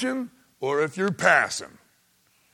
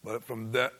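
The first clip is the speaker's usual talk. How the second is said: ordinary talk, nothing marked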